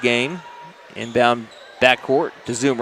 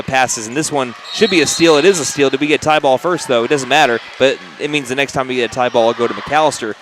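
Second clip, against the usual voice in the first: normal talking voice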